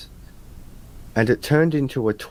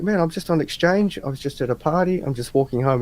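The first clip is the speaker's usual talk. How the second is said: high, flustered explanation, easing off; retold freaking out; 7 semitones higher than usual. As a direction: re-enact a nervous excuse. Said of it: "I was freaking out".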